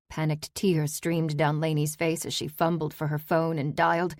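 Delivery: ordinary delivery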